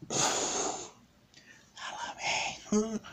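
eerie, unvoiced whisper. Mysterious voice beckons the bear to follow, whispered. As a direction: whisper it very softly, like a voice from nowhere. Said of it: "My whispered voice said".

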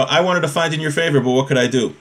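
normal talking voice

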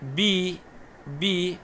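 بي held high as "bii"; long vowel. Shown as a demonstration. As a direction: draw out the i of بي. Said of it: "مد بالياء".

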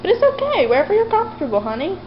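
high, loud soothing coo. Real, not acted. Owner comforts her scared dog on the rug, cooing.

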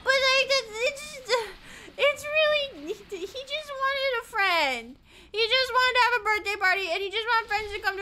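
half-crying over a sad video; emotional. Real, not acted. Streamer gets teary telling chat about a lonely mascot. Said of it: "they make me emotional".

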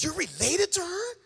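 high-pitched retold disbelief; astonished. Dad learning she is Orlando's family, astonished. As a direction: voice leaping up in astonishment.

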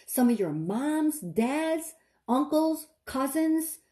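fiery preacher, rising pitch; preaching. Acted. Preacher warning the flock about demons, fiery. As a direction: preach it out to the congregation.

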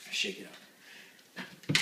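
slow unvoiced whisper. Real, not acted. Muttering to himself while shaking the bottle, slow whisper. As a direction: breathe it out slowly.

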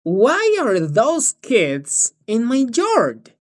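put-on "Why are those kids in my yard?" said slowly, each word clear; slow model question. Shown as a demonstration. (pregunta modelo, despacio y clara)

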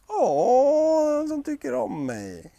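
high gasp then slow pleased; delighted wolf. Acted. Fenris being tickled by Röskva, high delighted oh. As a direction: melt with delight.

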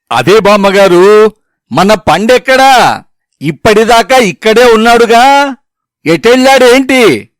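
calling out loudly and insistently; loud shouting. A narrator's reading. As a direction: shout slowly for a hard-of-hearing granny. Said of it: "గట్టిగా అరిచాను".

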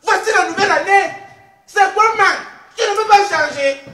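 loud, high-pitched comic telling-off; mock scolding. Host tells her co-hosts off for never changing, mock scolding. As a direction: scold them loudly, pitch up.

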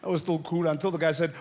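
ordinary talk

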